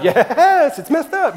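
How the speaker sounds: upbeat answer instead of moping; Tigger-style cheer. Pretend. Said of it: "tigger it up".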